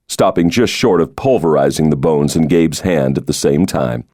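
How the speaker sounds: usual speaking voice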